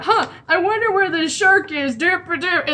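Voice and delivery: high, dopey mock voice; mock diner chatter. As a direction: play oblivious diners as lightly goofy caricatures. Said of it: "when they're just sitting at the diner, just talking about".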